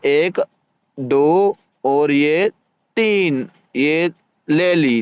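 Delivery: slow, suspenseful count with long-held words; drawn-out counting. Real, not acted. Player counting for viewers as the car gets taken, drawn out. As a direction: stretch each count out slowly.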